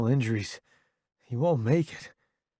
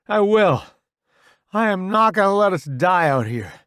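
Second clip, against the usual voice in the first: loud, raised, firm vow; forceful, urgent resolve; determined; 7 semitones higher, 7 dB louder than usual. A narrator's reading. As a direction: refuse to accept defeat. Soldier vows to save friends.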